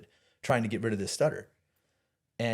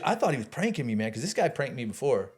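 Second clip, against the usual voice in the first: ordinary talk, nothing marked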